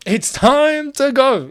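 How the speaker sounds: mock-solemn slow quote of the wise man; drawn-out quote. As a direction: linger on every word like a sage. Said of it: "a wise man once said".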